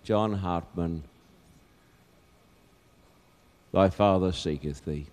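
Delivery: low, soft, tender call; hushed call. Acted. God seeking the lost in despair, hushed call. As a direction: drop right down and say it gently.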